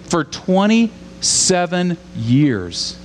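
twenty-seven years drawn out very slowly; slow emphasis. Shown as a demonstration. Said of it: "I want you to get your mind around this".

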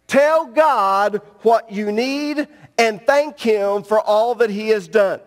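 ordinary talk

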